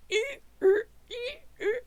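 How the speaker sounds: very high, loud strained whining noises; whiny crawling whimpers. Acted. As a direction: squeal like a desperate pet crawling on its knees. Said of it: "you just hear the sounds of".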